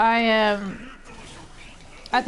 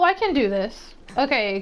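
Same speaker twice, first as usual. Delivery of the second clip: ordinary delivery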